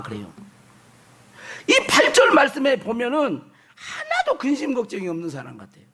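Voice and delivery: usual speaking voice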